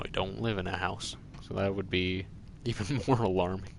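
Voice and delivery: ordinary delivery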